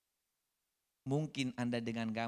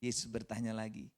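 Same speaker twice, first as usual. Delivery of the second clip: ordinary delivery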